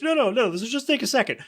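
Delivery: breezy threat before violence; hammed-up sinister calm; mock menacing Lois.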